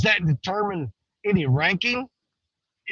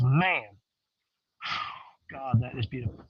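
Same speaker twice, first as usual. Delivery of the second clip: low, soft, hushed wonder; calm awe; 9 semitones lower, 8 dB quieter than usual. Retold or imitated. Alone by the water, softly admiring a fish in quiet awe. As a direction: murmur it in quiet reverence. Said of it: "I stayed calm and I just looked at it and goes".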